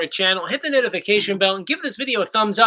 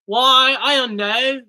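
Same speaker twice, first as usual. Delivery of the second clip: higher-pitched mock British accent; hammed-up British lilt; put-on British accent; 5 semitones higher, slower than usual. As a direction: play at being British, voice lifted. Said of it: "what accent is that?".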